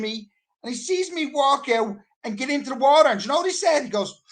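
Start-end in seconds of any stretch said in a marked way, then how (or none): none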